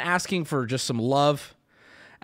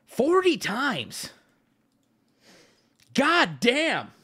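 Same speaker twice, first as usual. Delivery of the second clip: high, drawn-out exclamation of disbelief; shocked disbelief; 8 semitones higher, much slower than usual. Real, not acted. Streamer reacting to stabbed forty times, shocked disbelief. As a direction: stretch the words out high in shock.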